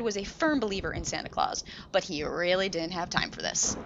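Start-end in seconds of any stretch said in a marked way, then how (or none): none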